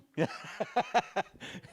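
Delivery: bright burst of laughter; high giggling laugh. Real, not acted. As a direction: laugh out at the joke. Laughing at the joke.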